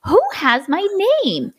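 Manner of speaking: high-pitched mock disbelief; retold indignant surprise. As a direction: act out being taken aback, pitched high. Retells indignant surprise at taken name.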